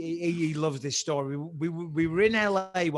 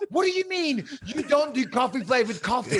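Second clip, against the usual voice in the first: high, loud mock outrage; exasperated retold disbelief, voice raised; wound up; 8 semitones higher, 5 dB louder than usual. Customer retelling his protest to the server, exasperated. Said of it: "starting to wind myself up".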